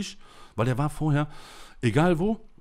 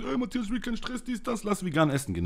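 higher, whiny mock voice of a rival backing down; mocking a rival who begs off in a higher voice; mocking Karl; 8 semitones higher than usual. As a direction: imitate him higher to make him sound weak. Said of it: "Karl sagt".

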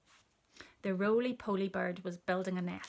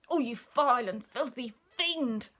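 higher, swooping curse; outraged cry; 5 semitones higher, 5 dB louder than usual. A narrator's reading. Elephant curses the child-eating crocodile, high outrage. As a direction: pile up the insults, building louder. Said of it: "cried Chunky".

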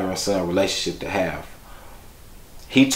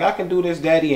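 high, confident child's voice, imitated; young son; 8 semitones higher than usual. Son showing Daddy no-hands riding, high child's voice. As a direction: play a little boy reassuring his father, pitched up. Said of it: "He like".

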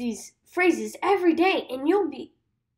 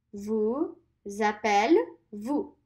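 "vous appelez-vous" stretched very slowly; slow teacher modelling; slow model; 4 semitones lower, much slower than usual. Shown as a demonstration. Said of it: "Let's do that one more time".

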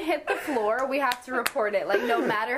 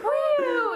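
relieved woo; 12 semitones higher than usual. Retold or imitated. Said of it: "and they're like".

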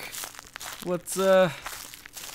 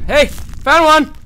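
high, loud victory whoops; triumphant cheering; 10 semitones higher, 7 dB louder, much slower than usual. Real, not acted. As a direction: celebrate the find out loud. Finds a page, celebrates.

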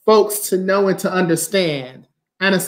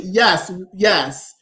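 ordinary delivery

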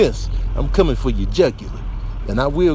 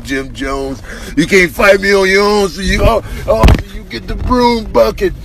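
loud, higher indignant yelling; angry taunting; 5 semitones higher, 6 dB louder than usual. Acted. Crab yelling at the janitor with the broom bucket, angry. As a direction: shout him down with outrage.

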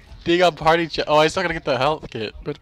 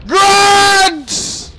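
high, loud drawn-out shout; shouted callout; 14 semitones higher, 6 dB louder than usual. Real, not acted. Player warning partner of enemies, drawn-out shout. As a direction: yell out the enemy warning.

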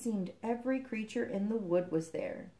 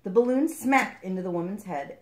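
plain reading voice

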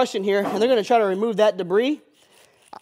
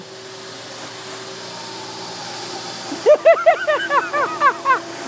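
airy laugh that starts high and falls away; high breathless laugh. Real, not acted. Cleaner laughing at the failing brush, airy high laugh. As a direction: burst out laughing at the useless machine. Machine fails, he bursts out.

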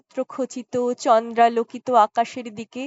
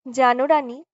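plain reading voice

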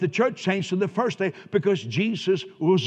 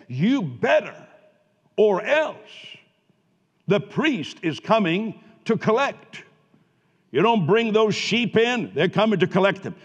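a mock threat, louder and slower; menacing threat; 4 semitones higher, 6 dB louder than usual. Pastor imitating the old law's threat to collect, mock menace. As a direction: lean in like an enforcer with a gun to their head. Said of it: "It was like a gun to their head".

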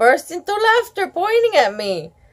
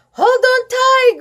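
hollered; 12 semitones higher than usual. Acted. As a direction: call to the girl on the horse. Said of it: "Grandpa Reed hollered".

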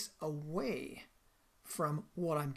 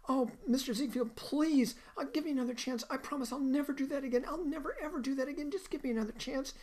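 put-on much higher, pleading little-girl voice as Fanny; apologetic pleading; 9 semitones higher than usual. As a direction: beg the boss for another chance, voice pitched way up. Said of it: "immediately she's all apologetic".

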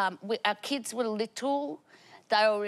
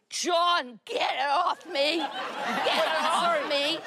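retold scream; 6 semitones higher, slower than usual. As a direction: yell it like sparks are on you. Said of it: "'cause I'm screaming".

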